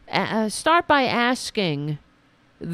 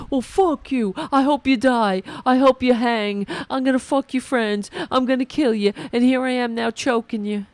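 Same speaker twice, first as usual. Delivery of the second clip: higher, louder hammed-up rage; mock raging man; 5 semitones higher, 4 dB louder than usual. Host mocking a violent ex in a raging voice. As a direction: rant it like a jilted thug. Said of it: "by being like".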